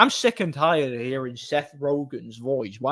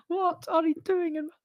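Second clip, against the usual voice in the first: mock whiny movie character, way up high; squeaky cartoon voice; 5 dB quieter than usual. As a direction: stretch it out in a squeaky cartoon falsetto. Requotes swamp line, cut off.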